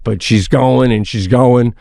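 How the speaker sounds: normal talking voice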